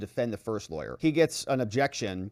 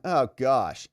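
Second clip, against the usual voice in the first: normal talking voice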